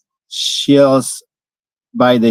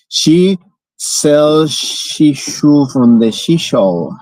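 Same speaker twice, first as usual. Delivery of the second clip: much slower, each word spelled out; slow careful try; much slower than usual. Shown as a demonstration. Learner trying the tongue twister, slowly and carefully. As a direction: ease through every word slowly. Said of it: "focusing on clearly saying she sells".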